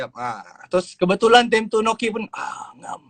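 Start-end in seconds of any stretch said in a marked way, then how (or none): 2.3-3.0 lazy whine. Retold or imitated. Noki acting lazy about school.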